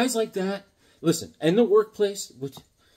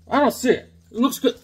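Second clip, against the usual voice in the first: mock protest; 8 semitones higher, 6 dB louder than usual. Scoffs at seller's note about chip.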